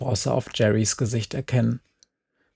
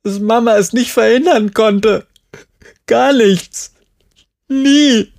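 higher and louder, on the edge of tears; crying it out as it overflows; tearful outburst; 10 semitones higher, 6 dB louder than usual. Acted. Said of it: "Jerry liefen jetzt Tränen über ihr Gesicht".

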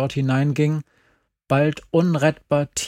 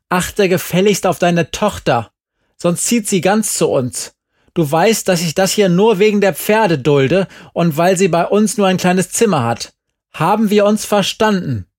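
loud, higher, threatening; stern warning; 5 semitones higher, 6 dB louder than usual. A narrator's reading. Angry mother warns the boy off her daughter, loud and cold. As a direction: lay down the law to him.

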